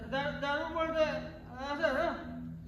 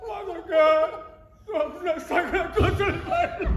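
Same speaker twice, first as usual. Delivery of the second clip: laughing-crying despair; 7 dB louder than usual. Acted. Despairing drunk man.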